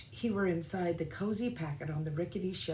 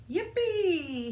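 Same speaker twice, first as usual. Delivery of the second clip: high, loud cry of joy; gleeful shout; 12 semitones higher, 5 dB louder than usual. Acted. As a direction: shout it out with pure glee. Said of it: "he shouted with glee".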